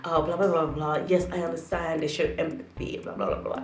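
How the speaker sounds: lightly voiced boss caving in, gently; mock apologetic manager. Manager apologising softly to the customer, mock placating. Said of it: "your manager just goes".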